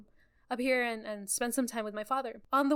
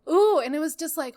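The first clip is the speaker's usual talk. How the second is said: normal talking voice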